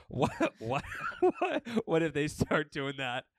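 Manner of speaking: ordinary talk, nothing marked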